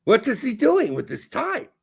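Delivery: high put-on German accent; comic German-accented English. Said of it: "you doing the German accent would be great".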